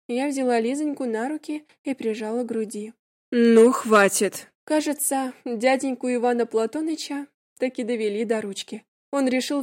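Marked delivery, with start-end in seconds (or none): 3.3-4.5 fed up. A narrator's reading. Uncle loses patience.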